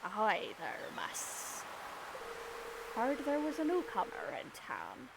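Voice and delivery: lightly voiced old sea pirate, friendly greeting; a hint of a salty pirate welcoming a newcomer; Willy pirate voice. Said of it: "I'm gonna give him a pirate voice".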